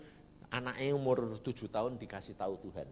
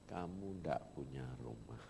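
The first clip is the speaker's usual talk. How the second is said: lower, softer, grave pronouncement; voice of God; 12 semitones lower, 11 dB quieter than usual. Retold or imitated. God telling the preacher he has no house, low and grave. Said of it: "Sampai saya tanya".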